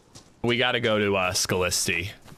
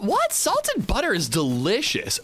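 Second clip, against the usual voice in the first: surprised; 9 semitones higher than usual. Real, not acted. Disbelief at chat's butter take.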